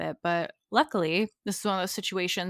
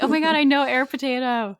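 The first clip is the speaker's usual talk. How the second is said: excited fan; 5 semitones higher, 6 dB louder than usual. Retold or imitated. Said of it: "are like".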